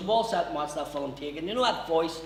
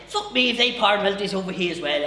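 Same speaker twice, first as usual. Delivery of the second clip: put-on Newry accent; 4 semitones higher, 5 dB louder, faster than usual. Newry man voiced.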